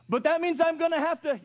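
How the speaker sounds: high whining complaint, very loud; whiny kid. Retold or imitated. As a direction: whine like a put-upon kid. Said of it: "child whining excuse".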